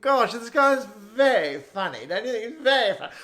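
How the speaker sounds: be amused in hammed-up upper-class British; stiff-upper-lip British amusement. Chelsea barrister praising a comic, stiffly posh British. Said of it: "laugh with a stiff upper lip".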